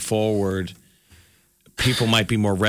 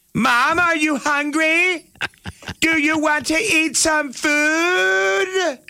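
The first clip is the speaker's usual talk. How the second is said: mock yelling at grandma; much slower than usual. Mom yelling to grandma about food, hammed-up shout. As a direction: shout each word to a deaf grandma. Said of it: "why is mom always yelling at grandma".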